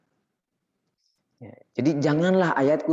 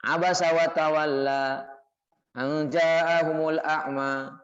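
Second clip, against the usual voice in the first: slow, drawn-out recited verse; measured scriptural recitation; Quran recitation; 3 semitones higher, much slower than usual. Acted. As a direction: recite the verse slowly, holding the long vowels.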